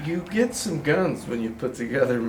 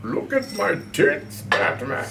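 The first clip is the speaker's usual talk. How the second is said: villain taunt; 6 semitones higher than usual. Pretend.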